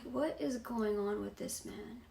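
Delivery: softly voiced inner thought; baffled aside. Girlfriend wondering about him mid-fight, quietly baffled. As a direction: say it softly, as if thinking to yourself.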